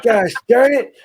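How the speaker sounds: put-on laughing old geezer exclaim; a cranky old-timer cussing mildly; old man voice. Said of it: "I sounded like some old guy".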